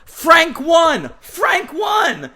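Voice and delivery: high, jubilant cry; excited mock shout. Pal on the phone announcing the win, high excited shout.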